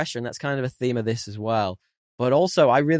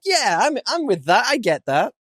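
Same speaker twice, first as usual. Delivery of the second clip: higher, lightly voiced smug viewer; mock smug agreement; 10 semitones higher than usual.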